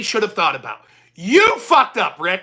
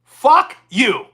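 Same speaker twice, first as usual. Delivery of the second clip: angry outburst; 6 dB louder than usual. Real, not acted. Ranting at Rick.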